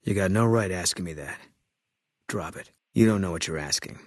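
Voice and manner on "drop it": quiet warning. Acted. Sniper refusing to discuss his wife, quiet warning. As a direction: say it under the breath, done arguing.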